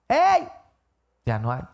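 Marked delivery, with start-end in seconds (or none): 0.1-0.5 sudden high, loud call; shouting. Shown as a demonstration. The speaker yells uselessly after someone who left, loud shout. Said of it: "Por mucho que le grites".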